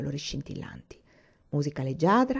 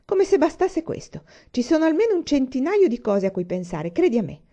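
much higher, louder exasperation; indignant wife; 6 semitones higher, 5 dB louder than usual. Acted. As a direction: scold her husband, fed up. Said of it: "disse la moglie indignata". (moglie indignata, esasperata)

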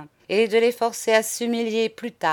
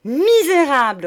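high, loud cry of outrage; furious roar; 6 semitones higher, 7 dB louder than usual. A narrator's reading. Madame advancing on monsieur in fury, roaring. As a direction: roar the insult. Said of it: "rugit-elle enfin".

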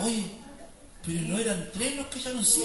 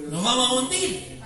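forceful warning; 2 semitones higher, 5 dB louder than usual. Shown as a demonstration.

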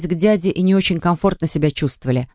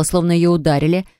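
usual speaking voice

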